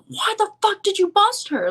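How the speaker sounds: put-on high-pitched angry Russian accent yell. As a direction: shout it like a furious Russian guy. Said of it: "in this thick Russian accent".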